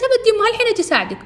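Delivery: very high, loud and fast; high-pitched, rapid eager blurting; hurried daughter's reply. Retold or imitated. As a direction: squeal out a rushed offer to help. Daughter hurriedly answers her scolding mother.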